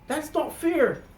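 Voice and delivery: high, exasperated protest; indignant. Real, not acted. A customer protesting against the banks, high and outraged. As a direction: throw the words up high in outrage.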